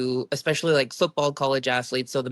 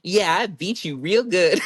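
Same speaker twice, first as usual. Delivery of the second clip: sassy; 3 semitones higher, 3 dB louder than usual. Retold or imitated. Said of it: "decided to be sassy".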